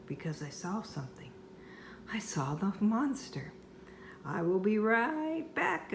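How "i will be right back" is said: a called-out mock yell. A narrator's reading. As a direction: shout it back over the shoulder. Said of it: "I yelled".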